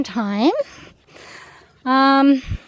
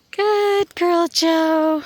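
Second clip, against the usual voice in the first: praising dog; 6 semitones higher, 5 dB louder, much slower than usual. Real, not acted.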